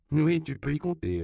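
high, loud chiming in; emphatic agreement. A narrator's reading. Daughter backs her mother's promise to him, emphatically. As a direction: pile on, pitched well up. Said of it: "renchérit Dorothy".

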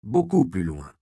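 firm push on the first word; insistent follow-up order. A narrator's reading. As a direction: urge them much further away. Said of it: "insista Andreas".

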